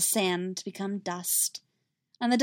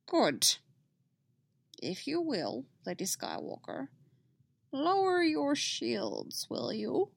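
slow, higher Yoda request; 5 semitones higher, 4 dB quieter, slower than usual. Acted. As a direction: gently coax her in Yoda's voice. Yoda voiced in story.

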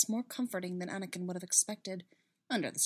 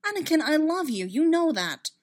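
high, loud, urgent reassurance; exasperated declaration of love; insistent pleading; 8 semitones higher, 7 dB louder than usual. Acted. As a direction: plead with him to believe it. Pleading with Anakin in a quarrel.

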